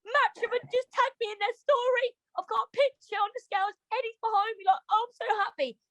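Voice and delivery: high, teary gushing; telling a boxer she's overjoyed, high and teary; retold happy crying. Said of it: "I started crying".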